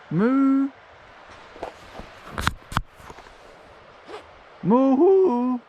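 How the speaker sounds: cow moo. Pretend. Mooing at the cows.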